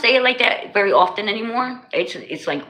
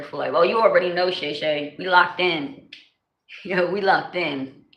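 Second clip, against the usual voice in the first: usual speaking voice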